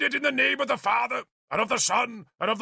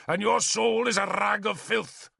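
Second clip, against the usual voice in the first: higher, contemptuous denunciation; scornful bishop; 8 semitones higher than usual. A narrator's reading. Bishop condemning Arthur's soul, higher and scornful. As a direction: condemn his soul with loathing.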